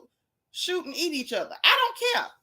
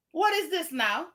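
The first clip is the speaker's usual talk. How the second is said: usual speaking voice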